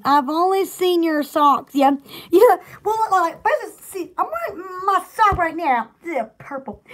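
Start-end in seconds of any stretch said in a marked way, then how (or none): none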